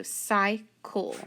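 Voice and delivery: "cycle" slowly split as sy-cul; sounded out by syllable. Shown as a demonstration. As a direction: sound out CYCLE in two beats. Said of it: "I need to put that dot".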